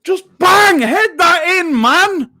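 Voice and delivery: high, loud urging yell; loud, slow, high command to head it; real shout. Real, not acted. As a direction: shout the player on. Urging a player at a cross.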